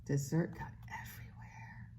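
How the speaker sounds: hushed mock-dismayed whisper. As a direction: whisper the mess news. Whispered aside.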